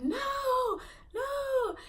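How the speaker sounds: high, wailing mock scream; retold screaming. Granddaughter refusing her hallucinated grandpa, high retold scream. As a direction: re-enact a panicked, drawn-out wail. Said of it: "I'm just started screaming".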